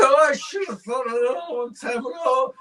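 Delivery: high, loud mock sobbing; crying drunk. Drunk husband answering the bartender, high comic crying. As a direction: blurt it out in a hammed-up wail. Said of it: "he's crying".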